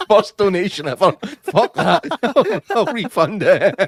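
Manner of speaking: high, loud talk through laughter; real laughing fit. Real, not acted. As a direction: crack up while getting the words out. Cracking up at the refund offer.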